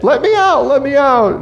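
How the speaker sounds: high, piercing mock wail from the pit; mock wailing Joseph. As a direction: wail it like a trapped boy, hammed up. Brother in the pit.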